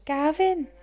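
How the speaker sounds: high Welsh Gavin; Welsh accent. Retold or imitated. Joining in the Welsh Gavin. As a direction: sing out Gavin with a lilt. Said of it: "Say Gavin".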